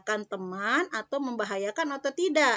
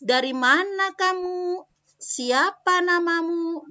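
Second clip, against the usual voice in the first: high, slow, louder questions; questioning tone; 7 semitones higher, 5 dB louder, slower than usual. Shown as a demonstration. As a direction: ask curiously like a child would. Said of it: "Cara membacanya berbeda ya, bertanya".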